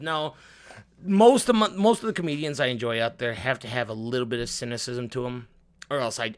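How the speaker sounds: usual speaking voice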